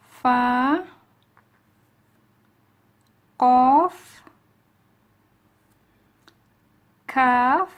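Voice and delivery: each letter called out loud for repeating; loud letter calls. Shown as a demonstration. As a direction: belt out the letters to the class. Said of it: "repeat after me".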